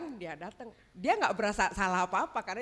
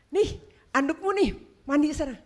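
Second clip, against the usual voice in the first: higher, louder doting mother-in-law; 5 semitones higher, 7 dB louder than usual. Retold or imitated. Mother-in-law fussing over her son, higher and louder. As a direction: hand him the towel like a fussing mother.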